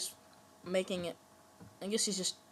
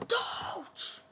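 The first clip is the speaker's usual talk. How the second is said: startled shriek; 8 dB louder than usual. Real, not acted. Dog companion attacks in game.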